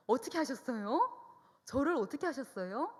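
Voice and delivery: stunned, almost laughing; astonished. Retold or imitated. Daughter astonished by the prophecy. (깜짝 놀라 크게 묻듯)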